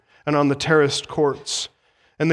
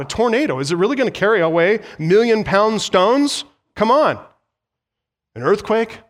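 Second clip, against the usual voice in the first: loud, higher, driving sermon voice; fiery pulpit warning that the temple falls; full-force preaching; 3 semitones higher, 3 dB louder than usual. Acted. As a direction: preach it hard to the congregation. Preacher voices the disciples' disbelief.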